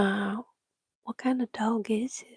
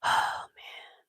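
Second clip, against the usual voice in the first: ordinary talk, nothing marked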